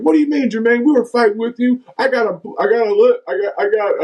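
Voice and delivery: high-pitched, indignant imitation; retold protest. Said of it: "he was like".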